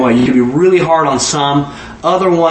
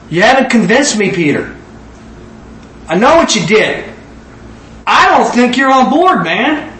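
doubting Jesus; 7 semitones higher than usual. Acted. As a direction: press Peter, not convinced yet. Jesus voiced doubting Peter.

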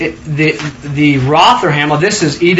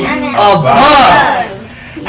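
laughing repeat; 6 semitones higher, 3 dB louder, much slower than usual. Shown as a demonstration. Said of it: "Say it again".

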